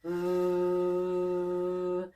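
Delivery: long steady voiced hum on one note; sustained V hum. Shown as a demonstration. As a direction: hold an easy V sound. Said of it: "turn the F into a V".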